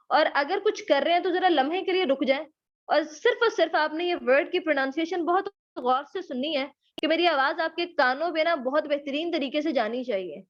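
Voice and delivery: normal talking voice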